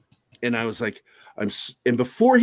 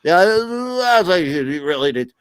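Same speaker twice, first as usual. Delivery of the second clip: laughing mockery; 2 semitones higher, slower than usual. Mocking manager, slow, laughing.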